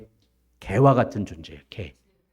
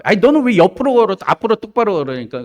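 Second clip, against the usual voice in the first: loud, raised mock telling-off; mother crab scolding; 9 semitones higher, 7 dB louder than usual. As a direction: scold the child in exasperation. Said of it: "개 엄마가 그런 거예요".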